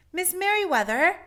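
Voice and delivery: high, loud call down the hall; calling loud and high down the library hall. Acted. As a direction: call her name from far off. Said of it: "he called as he walked".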